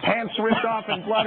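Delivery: ordinary talk, nothing marked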